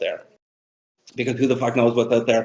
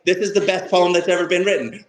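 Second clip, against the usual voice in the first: high, eager no no no; excited protest; 6 semitones higher than usual. Retold or imitated. Teacher to embarrassed student, excited and high. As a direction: insist with delight. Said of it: "And I was like".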